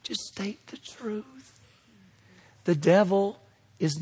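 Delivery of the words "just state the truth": slow, soft unvoiced whisper. Shown as a demonstration. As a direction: breathe the truth out gently, no volume. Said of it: "The louder it is doesn't mean the better it is".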